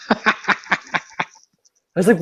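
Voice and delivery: ordinary delivery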